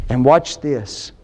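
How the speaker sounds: ordinary talk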